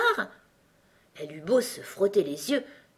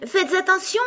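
high, loud warning cry; cried warning; 12 semitones higher, 5 dB louder than usual. A narrator's reading. Alice warning the queen about the brooch, urgent cry. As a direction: call out an urgent warning, pitched right up. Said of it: "cria Alice".

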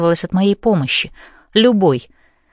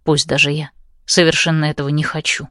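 usual speaking voice

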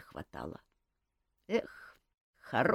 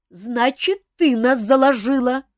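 louder, higher accusing cry; angry accusation; 10 semitones higher, 10 dB louder than usual. A narrator's reading. Алиса обвиняет Камилу в доносе, громко и зло. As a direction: hurl the accusation. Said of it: "обернулась к ней Алиса".

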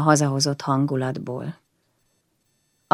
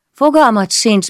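ordinary delivery, nothing marked

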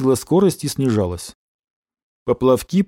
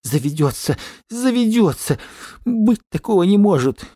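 muttering in denial; 7 semitones higher than usual. A narrator's reading. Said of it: "бормотал он".

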